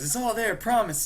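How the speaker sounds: high, loud mock pleading; hammed-up desperate assurance; Joker. Scared Joker swears it's all there.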